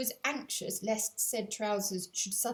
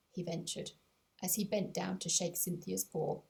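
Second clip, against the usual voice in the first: ordinary delivery, nothing marked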